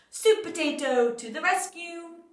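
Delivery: loud, slow, triumphant hero call; heroic announcement. A narrator's reading. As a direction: trumpet the hero's arrival. Hero's grand entrance.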